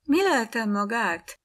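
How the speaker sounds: ijedt, magas felkiáltás; aggódva, felcsúszó hangon kiáltva; alarmed cry. A narrator's reading. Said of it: "kiáltozta körülöttem sürögve forogva". (megrémült nő kérdez)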